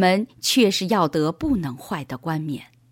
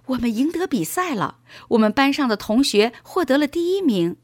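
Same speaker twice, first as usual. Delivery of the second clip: put-on higher-pitched, eager boy's voice; excited young boy; 5 semitones higher, 3 dB louder than usual. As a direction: report a win proudly to dad as a boy. Narrator voices boy Paul reporting. (小男孩兴奋地报告，音调较高)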